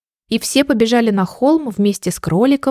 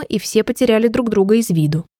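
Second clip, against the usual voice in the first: plain reading voice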